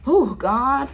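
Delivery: real emotion cracking high and loud; overwhelmed, barely holding it together; voice breaking. Real, not acted. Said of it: "I'm sorry, y'all".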